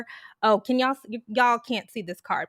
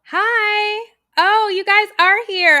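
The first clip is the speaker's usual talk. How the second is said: high, loud, relieved hello; excited greeting; 11 semitones higher, 8 dB louder, slower than usual. Real, not acted. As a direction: burst out greeting your audience. Spotting viewers who finally showed up.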